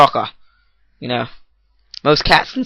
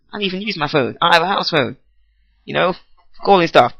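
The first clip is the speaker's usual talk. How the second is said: ordinary talk, nothing marked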